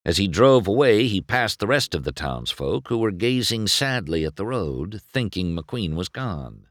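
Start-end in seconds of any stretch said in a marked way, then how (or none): none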